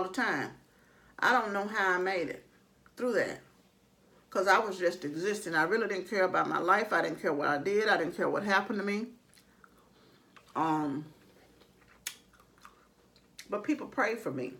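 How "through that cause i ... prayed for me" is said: talking while chewing; speaking around a bite of food; mouth full. Real, not acted. Seen 3: Fork loaded throughout; bite taken at 1.6, food visible in mouth 2.6.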